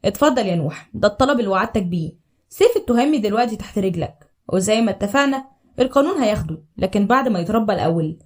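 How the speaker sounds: low, weighty elder's voice; imposing old man. Acted. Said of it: "عم فاروق قال بهيبة". (صوت راجل كبير واطي فيه هيبة)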